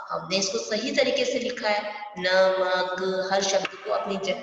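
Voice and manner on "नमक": drawn out slowly. Shown as a demonstration. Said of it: "slow demo of word".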